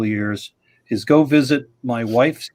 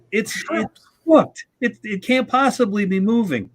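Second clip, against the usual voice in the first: disbelief; 8 semitones higher than usual. Retold or imitated. Disbelieving inner thought on seeing shrimp.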